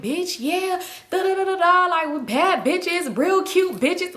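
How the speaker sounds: put-on high-pitched drunken hype chant; hype friend. Twerking friend hypes up her girls, hyped and high-pitched. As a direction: imitate a friend hyping the girls up.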